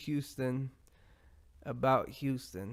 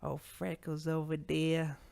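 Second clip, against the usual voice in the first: slow, higher mock Boston heckle; poor Boston accent; 4 semitones higher, slower than usual. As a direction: do a bad Boston accent, drawn out. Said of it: "That's my poor imitation of a Boston accent".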